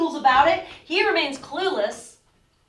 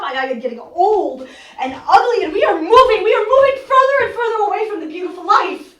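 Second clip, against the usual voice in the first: half-laughing, half-breaking as the words climb louder; bitter laugh to keep from crying; laughing through despair; 4 semitones higher, 6 dB louder than usual. Acted. Irina to her sister Olga, laughing despairingly.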